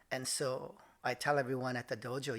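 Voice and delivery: normal talking voice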